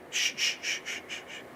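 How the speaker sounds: soft unvoiced shh sounds fading away; teacher demonstrating a whisper shush. Shown as a demonstration. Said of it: "That voice start to whisper".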